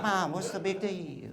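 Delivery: high, slow little kid attempting a Chinese accent; drawn-out childish mock Chinese accent. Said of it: "trying to do a Chinese accent in, like, a little kid voice".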